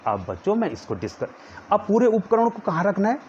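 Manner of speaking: ordinary talk, nothing marked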